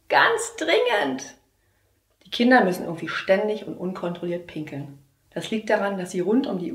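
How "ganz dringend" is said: put-on slow, high, strained little boy; child, choked voice. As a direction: plead like a desperate kid. Boy begs to go. (gepresste Kinderstimme)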